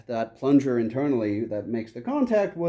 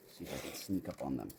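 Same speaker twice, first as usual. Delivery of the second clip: hushed and much softer, sneaking up; sneaking quietly; 12 semitones lower, 17 dB quieter than usual. Real, not acted. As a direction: keep it down so the animals don't hear. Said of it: "I'm gonna try to do this quietly".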